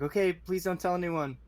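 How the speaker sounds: high-pitched secretive plea; pleading old man. Acted. Old man handing guard a stolen name tag, pleading. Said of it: "and I'm like".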